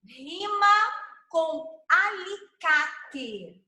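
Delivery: slow, stretched-out game call; drawn-out prompt. Shown as a demonstration. Teacher calls the next rhyme word to the group, drawn out. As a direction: draw out each syllable of "alicate".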